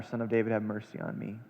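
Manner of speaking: low, soft desperate pleading; hushed plea. Acted. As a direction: beg under your breath. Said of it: "he cried out all the more".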